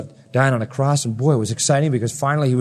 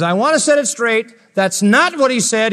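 higher, insistent correction; voicing John; 8 semitones higher, 4 dB louder than usual. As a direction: set the record straight firmly.

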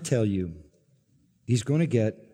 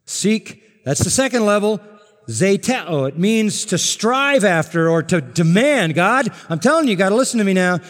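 higher, loud, insistent preaching; full-force preaching; 6 semitones higher, 6 dB louder than usual. Acted. Preacher urging bold prayer, loud and insistent. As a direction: storm the gates with your voice.

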